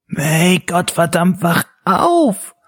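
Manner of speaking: high, cracking shrieky yell; shrill scolding. A narrator's reading. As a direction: screech at her to wake up. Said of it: "keifte Blowjob". (hohes, keifendes Kreischen)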